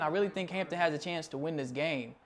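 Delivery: normal talking voice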